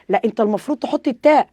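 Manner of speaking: normal talking voice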